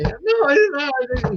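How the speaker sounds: drawn-out panicked squeal; mock-hysterical high-pitched denial; high, slow frantic wail.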